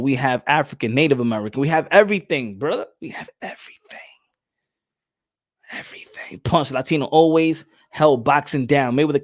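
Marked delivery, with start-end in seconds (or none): 3.0-6.4 hushed, secretive whisper for emphasis; dramatic whisper. Acted. Streamer boasting about Latinos to chat in a dramatic whisper.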